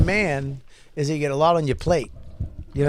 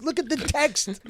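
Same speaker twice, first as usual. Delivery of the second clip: upset friend; 8 semitones higher, 3 dB quieter than usual. Retold or imitated. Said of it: "He goes".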